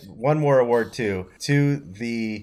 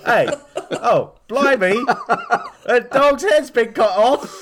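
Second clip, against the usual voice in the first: much higher, louder Cockney with laughter; hammed-up Cockney; 13 semitones higher, 5 dB louder than usual. Playing the homeless woman in a shocked, hammed-up Cockney. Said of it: "that's pretty good".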